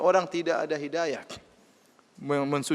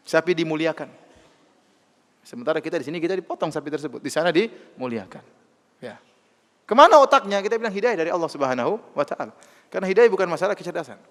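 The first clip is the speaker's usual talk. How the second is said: ordinary talk, nothing marked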